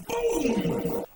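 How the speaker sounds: high squeaky boom with a laugh; playful high boom; boom sound effect. Acted. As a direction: shout out the stomp noise. Shoe heel stomps dragon.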